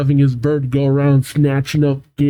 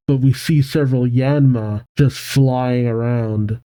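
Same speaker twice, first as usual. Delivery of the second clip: ordinary delivery, nothing marked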